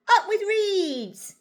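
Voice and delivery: high, loud, drawn-out cheer; hooting. A narrator's reading. Narrator voices duckbills delighted by the river, hooting. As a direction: hoot it like a happy chant. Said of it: "And they hooted".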